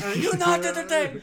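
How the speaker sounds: high, laughing Gladiator quote; booming defiant gladiator. Retold or imitated. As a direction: shout it to the arena crowd, laughing. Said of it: "Oh, sounds like Gladiator".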